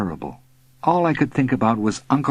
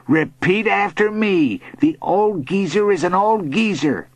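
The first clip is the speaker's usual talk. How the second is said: insistent bully; 9 semitones higher than usual. A narrator's reading. Bully twisting the boy's wrists, insistent. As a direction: press each phrase on your victim.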